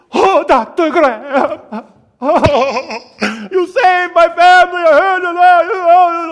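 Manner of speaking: high, loud, tearful outpouring; a loud, high, weeping gush of gratitude; tearful gushing fan. Retold or imitated. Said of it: "he goes".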